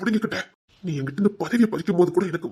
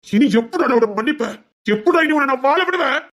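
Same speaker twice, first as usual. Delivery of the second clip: higher, louder furious cry; villain roaring he will never forgive; enraged shout; 7 semitones higher, 4 dB louder than usual. Acted. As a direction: roar your vengeance. Villain vowing vengeance.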